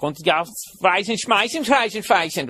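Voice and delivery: high, made-up Danish-Swedish babble; mock Scandinavian gibberish. Comedian mocking a foreign heckler's language, high mock babble. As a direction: fake a Nordic language he can't place. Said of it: "he's speaking, uh, I don't know, Swedish".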